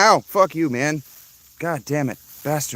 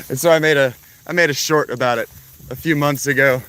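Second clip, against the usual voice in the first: normal talking voice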